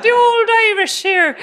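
high, loud mock-Irish brogue; bad Irish accent. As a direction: ham up a terrible Irish accent. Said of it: "If that's your Irish".

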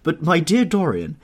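high, loud astonished protest; perplexed amazement. A narrator's reading. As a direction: protest loudly, utterly taken aback. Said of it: "looking at him in perplexed amazement".

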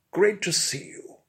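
pompous expert hails his visitor; Bunfrey's greeting. Acted. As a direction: greet him like a grand host. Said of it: "my honeyed, liquid, and highly well-pronounced tones".

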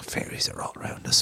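hushed, secretive whisper; mystical whisper. Acted. Telling friends fairies surround them, in a whisper. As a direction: confide it like a sacred secret.

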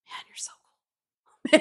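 slow breathy whisper; hushed, lovestruck murmur; whispered awe. Retold or imitated. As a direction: breathe it out slowly in wonder. Date murmuring admiration.

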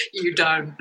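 laughing. Real, not acted. Laughs at request to dance.

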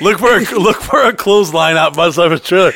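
high loud excited rant. Real, not acted. Joking about the friend's trailer, loud excited rant.

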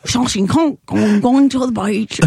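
mock Russian accent; loosely improvised Russian-accented English. Shown as a demonstration. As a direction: say it like a cartoon Russian. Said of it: "Russian people are like".

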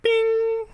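high electronic bing; ping like a smartwatch; watch ping sound. Acted. GM voicing Nigel's smartwatch, high ping.

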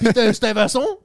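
loud, high mock-French question; hammed-up French accent on a brand name; bad French accent. Replaying his tobacco shop request, bad French accent. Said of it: "my accent's no good".

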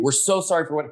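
normal talking voice

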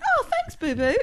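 very high squeaky thanks; delighted little boy. Retold or imitated. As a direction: chirp it happily like a toddler. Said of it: "he went".

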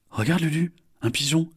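bright, eager cry; excited exclamation. Acted. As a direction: point it out gleefully. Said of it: "s'exclame Isor".

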